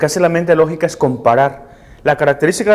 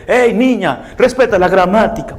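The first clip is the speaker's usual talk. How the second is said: higher-pitched, finger-wagging reprimand; mock scolding; 7 semitones higher than usual. Adult correcting a little girl's grammar, mock stern scolding. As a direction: scold a child over grammar.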